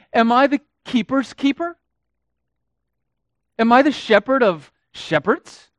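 high, loud, sneering mock question; mock God with cynical pride; sarcastic mockery. Cain mocking God with sarcasm, loud and high. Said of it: "cynicism and sarcasm in his voice".

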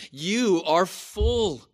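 slow, high preacher's mock praise; mock acclaim, each word stretched; biting sarcasm. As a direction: draw it out loudly with sarcastic bite.

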